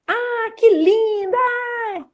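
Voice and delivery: very high, drawn-out squeal of admiration; gushing exclamation. Shown as a demonstration. Teacher showing exclamation intonation, gushing high and loud. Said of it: "pra exclamação".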